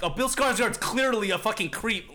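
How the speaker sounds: exasperated viewer. Retold or imitated. Viewer warning the woman about the creep, exasperated. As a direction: imitate a viewer fed up with the character.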